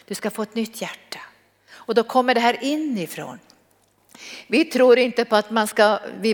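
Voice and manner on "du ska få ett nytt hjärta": whisper. Acted. Intimate promise to listeners.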